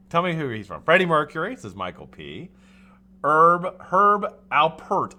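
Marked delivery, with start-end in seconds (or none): 3.2-5.1 odd drawn-out name. Real, not acted. Streamer reading a chat name he doesn't know, slow and high. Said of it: "I don't know who that is".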